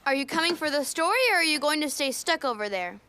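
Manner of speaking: usual speaking voice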